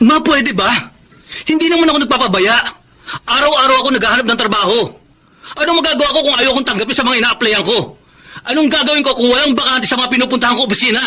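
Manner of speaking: pitched high, heated and defensive. Acted. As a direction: protest hard, voice climbing, with a bitter laugh at the end. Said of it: "Pinagtatasan mo ba ako ng boses".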